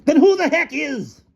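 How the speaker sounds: high, loud yell; exasperated high-pitched shout; frustrated outburst. Acted. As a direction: explode with frustration. Punches wall, frustrated outburst.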